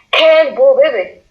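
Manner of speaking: "Quel beau bébé" rising on "Quel", falling to "bébé"; rise then fall. Shown as a demonstration. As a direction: up at the start, down at the end. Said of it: "montante, puis descendante".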